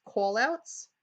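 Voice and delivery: New York accent on call outs. Real, not acted. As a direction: says call outs New Yorker style. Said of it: "excuse my New York accent".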